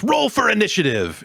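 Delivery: high-pitched hammed-up yell; mock shout. Host mocking Tiny Tina's joke line, mock shout. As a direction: shout it out like an over-excited game character. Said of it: "she shouts".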